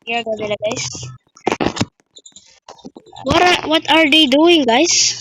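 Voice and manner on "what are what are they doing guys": loud, slow puzzled question; surprised. Real, not acted. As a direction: call out in disbelief. Surprised at others.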